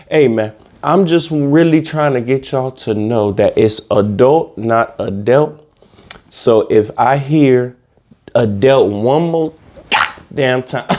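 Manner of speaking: ordinary delivery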